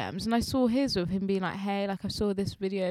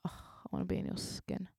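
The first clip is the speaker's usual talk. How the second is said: breathy whisper, much softer; whispered quote; 14 dB quieter than usual. Retold or imitated. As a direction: whisper it like a tipsy confession. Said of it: "I was like".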